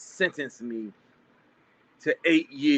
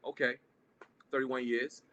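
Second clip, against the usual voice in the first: outward calm; 8 dB quieter than usual. Retold or imitated. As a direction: keep a calm face, play it cool. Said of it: "But in my face, I'm like".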